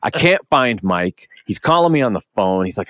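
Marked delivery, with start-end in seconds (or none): none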